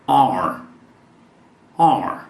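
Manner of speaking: "are" as the fast reduced form of our; "our" clipped to "are". Shown as a demonstration. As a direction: say "our" like "are", the way natives do quickly. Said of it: "quando nativos falam rapidamente, você vai ouvir".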